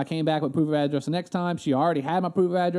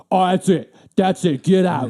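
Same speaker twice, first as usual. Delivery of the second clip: louder, furious get-out; livid clerk; 7 dB louder than usual. Retold or imitated. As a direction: throw him out in a rage. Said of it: "she's fucking livid".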